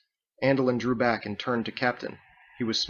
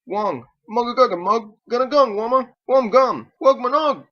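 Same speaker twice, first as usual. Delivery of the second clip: high, loud honking behind a gas mask; muffled honking gibberish; 12 semitones higher, 9 dB louder than usual. Acted. Drake tries to talk through his gas mask, muffled honking. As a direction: honk the words through a mask. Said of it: "The mask muffled his speech into honking gibberish".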